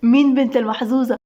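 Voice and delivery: ordinary delivery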